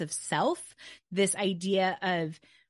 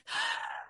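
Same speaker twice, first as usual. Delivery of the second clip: a deep audible breath in through the mouth; 5 dB quieter than usual. Shown as a demonstration. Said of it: "I breathe through my mouth, a deep breath".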